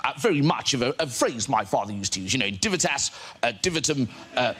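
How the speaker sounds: blustering, halting politician impression; Boris Johnson. Politician avoiding a question with flattery and Latin, blustering impression. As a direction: imitate a stammering politician padding with flattery and Latin. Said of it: "And he'd go".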